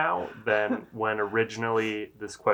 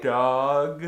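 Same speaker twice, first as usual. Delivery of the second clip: sounding out words; 2 semitones higher, 7 dB louder than usual. Shown as a demonstration. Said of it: "I have to sound out each word".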